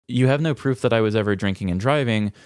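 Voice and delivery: ordinary talk, nothing marked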